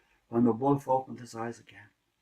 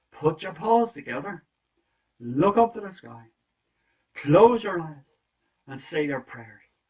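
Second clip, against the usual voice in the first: emphatic instructions; 5 semitones higher, 11 dB louder, slower than usual. Acted. Rooster directs prayer.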